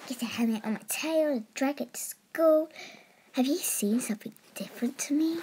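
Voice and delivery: breathy whisper. Real, not acted. Narrator whisper aside.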